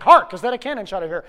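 loud, quick stage line; declaimed rehearsal. Acted. Actor rehearsing at his roommates, loudly declaimed.

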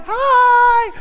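high, loud, drawn-out greeting; oblivious happy kid. Retold or imitated. Son mid-game greeting dad, high gleeful holler. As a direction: sing out cheerfully like a clueless child.

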